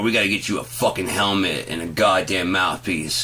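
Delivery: ordinary talk, nothing marked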